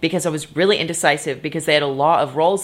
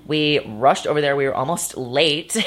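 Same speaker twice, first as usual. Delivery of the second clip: usual speaking voice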